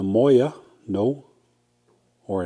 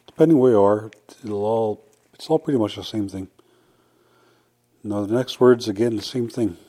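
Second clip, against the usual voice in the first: usual speaking voice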